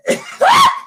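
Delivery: squealing laugh of disbelief; a high squeal of a laugh. Real, not acted. Reacting to the woman, a high squealing laugh.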